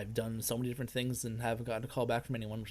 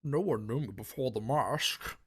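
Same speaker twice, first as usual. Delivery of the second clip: raspy masked villain; 5 dB louder, slower than usual. Acted. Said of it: "The rasping vo".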